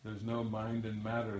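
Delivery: ordinary talk, nothing marked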